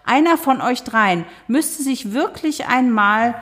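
high, slow, reproachful; reproachful neighbour. Acted. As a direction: lay on the blame like a nagging neighbour. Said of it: "Lorettas Stimme klang vorwurfsvoll".

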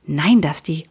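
usual speaking voice

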